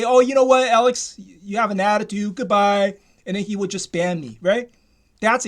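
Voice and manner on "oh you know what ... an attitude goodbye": imitated brusque brush-off, starting loud and raised; mock dismissive host. Host kicking Alex off the show, mock dismissive. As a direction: act out a host cutting a caller off.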